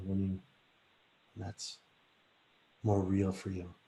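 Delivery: slow, softening relaxation guidance; guided relaxation. Acted. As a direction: ease the listeners into calm, slowly. Leads a relaxation exercise.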